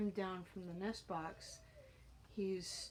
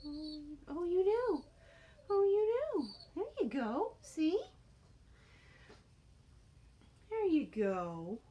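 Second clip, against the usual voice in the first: high, louder cooing at the bird; excited pet talk; 8 semitones higher, 5 dB louder than usual. Real, not acted. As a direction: gush over him like a proud owner.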